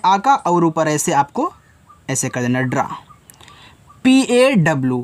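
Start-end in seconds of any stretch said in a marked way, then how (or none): 2.6-2.8 ड्रा with its vowel slightly through the nose; lightly nasalized. Shown as a demonstration. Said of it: "हल्का सा इनको नाक में पढ़ा जाता है". (ड्रा को हल्का सा नाक से बोलकर)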